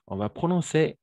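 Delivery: normal talking voice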